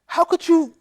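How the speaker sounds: high, loud furious scolding; angry shout. Acted. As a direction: tear into the beggar. Rich man scolding the beggar.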